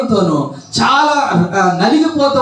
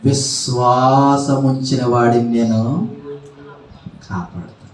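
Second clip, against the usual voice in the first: God answering; 8 semitones lower, 3 dB quieter than usual. Acted. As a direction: let God reply low and steady. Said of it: "దేవుడు అంటున్నాడు".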